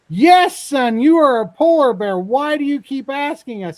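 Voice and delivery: hammed-up dad yelling, high and loud; exasperated shout. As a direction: blow up at the pestering cub, playacted. Exasperated dad bear yells at cub.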